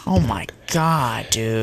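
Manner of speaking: slow breathless whisper; whispered disbelief. Real, not acted. Reacting in disbelief to stats.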